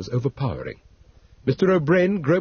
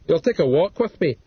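Lockhart, commanding; 5 semitones higher, 3 dB louder than usual. A narrator's reading. Narrator voices Lockhart.

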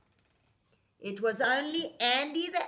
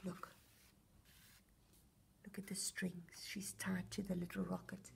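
whisper; 6 semitones lower, 14 dB quieter than usual. Real, not acted. Aside to child.

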